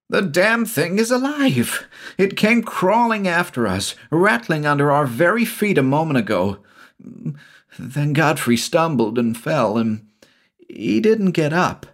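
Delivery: high-pitched, horrified outburst; panicked yelling. A narrator's reading. Shula insisting the stuffed snake attacked, panicked yelling. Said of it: "yelled Shula".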